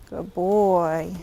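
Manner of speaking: low, soft murmur to the horse; soft, low praise for the horse. Real, not acted.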